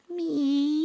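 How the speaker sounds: high, questioning cat meow. Acted. Detective calls to the cat in the basket, questioning meow. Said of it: "I fire a questioning cat".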